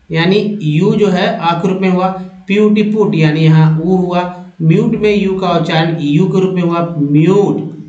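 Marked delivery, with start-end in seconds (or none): none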